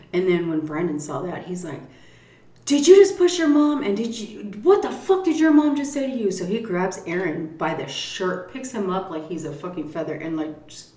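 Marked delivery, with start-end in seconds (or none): 2.6-6.3 much higher, louder furious demand; imitated angry shout. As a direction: confront him like an outraged stepfather. Brandon furious at Aaron.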